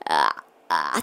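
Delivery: very low, creaky vocal fry. Shown as a demonstration. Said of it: "エッジボイスっていうのは".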